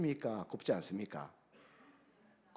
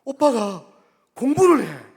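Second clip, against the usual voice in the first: much higher and much louder amazed announcement; excited daughter; 13 semitones higher, 22 dB louder, slower than usual. Retold or imitated. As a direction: burst out the news in astonishment. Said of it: "했더니 하는 말이". (오빠 소식을 엄마에게 전하는 딸, 놀라 외치듯)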